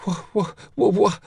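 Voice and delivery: high, stuck half-word repeated; shocked, broken stutter; stammering. A narrator's reading. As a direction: struggle to form the question and fail. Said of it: "all she could get out was".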